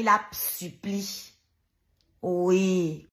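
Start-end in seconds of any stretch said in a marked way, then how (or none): none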